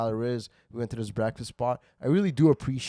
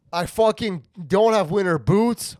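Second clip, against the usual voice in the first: louder, higher, slow exasperated telling; worked-up retelling; 8 semitones higher, 7 dB louder than usual. Acted. Comic telling a friend about the snowstorm, getting worked up. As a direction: build the outrage. Telling how he was stuck.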